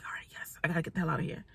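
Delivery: hushed private celebration; secretive whisper. Retold or imitated. To herself once he goes back out, a relieved secretive whisper. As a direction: whisper it to yourself, then barely voice it.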